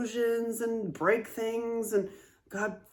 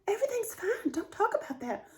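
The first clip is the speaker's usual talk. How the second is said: much higher, strained voice of a woman holding in pain; a tense, high, pained hush that builds louder; grandmother's voice; 10 semitones higher, 3 dB louder than usual. Retold or imitated. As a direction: voice pushed up high and tight, like it hurts to speak. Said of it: "It's like".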